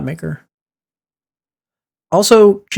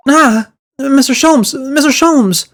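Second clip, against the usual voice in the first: alarmed cry; 9 semitones higher, much slower than usual. Acted. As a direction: cry out for a fallen friend. Character cries out at gunshot.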